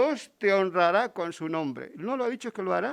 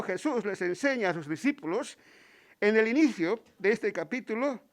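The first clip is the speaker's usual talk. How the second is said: ordinary delivery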